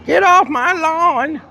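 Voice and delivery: put-on high, slow cranky old man. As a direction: yell it like a grumpy old geezer. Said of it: "in an old man voice".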